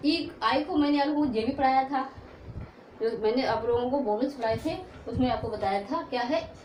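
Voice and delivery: ordinary talk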